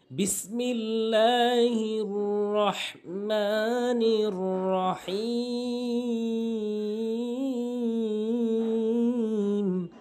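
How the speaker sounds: slow, high, drawn-out chanted recitation; Quran recitation. Shown as a demonstration. Teacher recites the basmala before the lesson, slow chant. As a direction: intone it slowly like tajweed.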